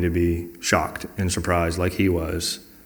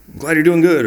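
higher, louder cheer for a friend; glad for someone; 9 semitones higher, 7 dB louder than usual. Acted. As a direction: celebrate their good news, voice lifted. Acting out sympathizing with good news.

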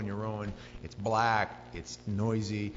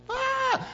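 high-pitched acted scream of fright; 4 dB louder than usual. Disciples see a figure on the water, screaming. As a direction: scream out as if seeing a ghost. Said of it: "It literally means they screamed".

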